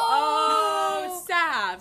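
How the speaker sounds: loud, high-pitched cry of protest; shocked scolding shout. Real, not acted. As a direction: call out her name in mock outrage. Scolding friend over her tone.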